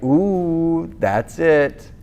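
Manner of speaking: drawn-out teasing "ooh"; mock taunt. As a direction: needle him, imitating a cocky kid. Retold taunt by Will.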